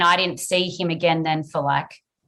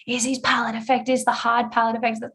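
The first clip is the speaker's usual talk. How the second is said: higher, urgent worried asking; anxious yelling; 6 semitones higher than usual. Retold or imitated. New mother calling out to doctors about the palate, urgent and worried. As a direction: demand it urgently, pitched up with worry. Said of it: "So I just start yelling".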